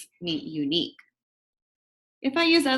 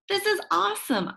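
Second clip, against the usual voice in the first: thrilled; 10 semitones higher than usual. Acted. Inner thought of delight.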